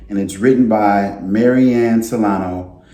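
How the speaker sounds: ordinary delivery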